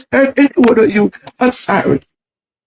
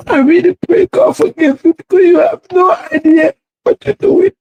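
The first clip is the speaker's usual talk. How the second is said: crying; 7 semitones higher than usual. Real, not acted. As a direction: pray through real tears, voice rising. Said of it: "She crying, y'all".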